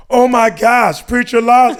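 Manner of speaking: high, loud mock fan excitement; imitated gushing recognition; starstruck fans. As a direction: exclaim it like fans spotting a star. Women spotting the comedian.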